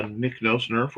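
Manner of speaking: ordinary delivery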